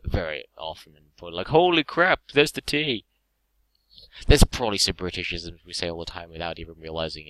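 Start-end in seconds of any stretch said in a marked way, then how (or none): 1.5-3.0 put-on British accent. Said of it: "in England we probably say".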